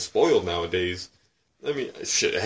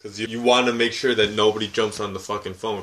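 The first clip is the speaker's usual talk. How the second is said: normal talking voice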